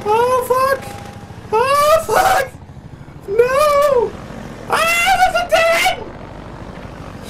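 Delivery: very high, squeaky game panic; frantic falsetto wailing; panicked squealing. Real, not acted. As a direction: squeal helplessly as it all goes wrong. Panicking when lost in game.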